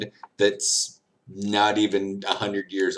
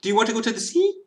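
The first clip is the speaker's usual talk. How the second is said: higher mock French accent, swallowing the words; 10 semitones higher, much faster than usual. Tourist mocking how the French talk, mock French accent. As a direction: lightly parody a French speaker. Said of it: "squeezing everything into octaves nobody can hear".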